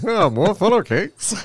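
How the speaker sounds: high, drawn-out feigned wonder; mock amazement. Colonists sneering at the time traveler's funnel cakes, mock amazement. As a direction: stretch it out high in mock awe.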